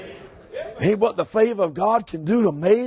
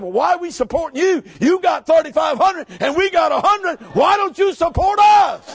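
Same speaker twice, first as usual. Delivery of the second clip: higher, louder, slowing challenge; mock-indignant shout; 8 semitones higher, 3 dB louder than usual. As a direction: shout it like a teasing protest. Retelling his challenge to pastor.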